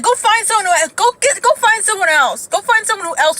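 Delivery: high-pitched exasperated urging. Acted. Speaker tells cousins to date someone outside the family, exasperated.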